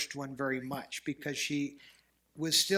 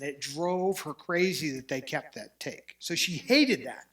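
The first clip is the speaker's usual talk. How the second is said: usual speaking voice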